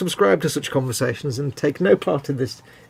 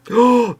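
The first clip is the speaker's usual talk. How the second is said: shocked gasp; 9 semitones higher, 5 dB louder than usual. Real, not acted. Shocked at critiquing wife's cooking.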